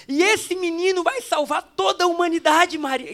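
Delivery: put-on the angel telling Maria her boy will save humanity; angel proclaiming. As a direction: proclaim it with awe. Angel continues announcement.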